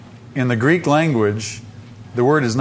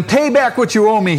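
high, forceful demand; insistent creditor's order; 7 semitones higher, 4 dB louder than usual. Acted. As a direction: demand repayment with raised pitch. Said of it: "he demanded".

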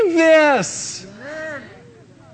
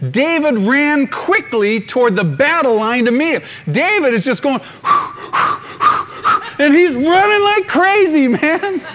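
usual speaking voice

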